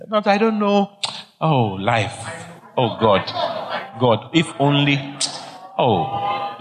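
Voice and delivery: mock woeful sighing to God; despairing lament. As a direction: moan it in broken, hopeless fragments. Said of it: "don't talk like that".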